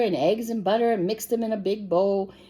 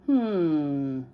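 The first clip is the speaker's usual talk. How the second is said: plain reading voice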